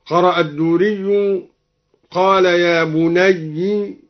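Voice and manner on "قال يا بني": يا بني drawn out slowly, ending "bunayyi"; kasra on yaa. Shown as a demonstration. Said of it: "بكسر الياء". (نطق بني بكسر الياء)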